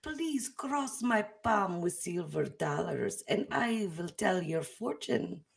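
slow, soft mystic fortune teller. Pretend. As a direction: lure them in like a carnival psychic. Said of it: "let me get my character on".

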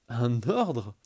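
loud mocking sneer; sneering panto villain. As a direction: scoff with contempt. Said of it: "ricana-t-il plus fort". (ricanement fort et méprisant)

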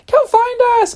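very high mock party squeal; excited calling out. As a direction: imitate an excited friend calling. Acting out friend's party invite.

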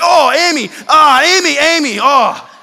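high, loud cries of pain; pained yelp. Pretend. Husband stubbing his toe at night, loud pained yelps. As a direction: yelp the name like you just stubbed a toe. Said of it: "you stub your toe at night".

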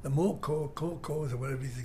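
ordinary talk

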